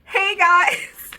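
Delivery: bright, loud high hello; excited greeting. Real, not acted. Greeting her viewers, overexcited and loud. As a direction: burst in thrilled to be back.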